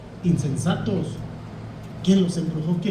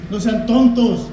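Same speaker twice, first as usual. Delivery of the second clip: sharp rebuke; 3 semitones higher, 7 dB louder than usual. Retold or imitated. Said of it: "le dice".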